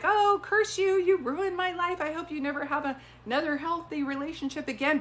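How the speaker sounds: high-pitched, theatrical curse on an ex; mock bitter cursing. Medium imitating bitter exes to a client, mock cursing. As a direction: play up a spiteful outburst, pitched well up.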